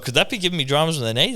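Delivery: quick, high, worried Scotty impression; mimicking Scotty. Scotty asking the doctor about his knee, fast and high. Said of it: "He's like".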